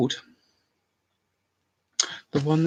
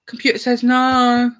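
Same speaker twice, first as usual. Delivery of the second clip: higher, slow, bored deadpan refusal; drawn-out jobsworth clerk at a desk; Computer says no catchphrase; 8 semitones higher, 3 dB louder than usual. Retold or imitated. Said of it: "something to do with, like, an airline".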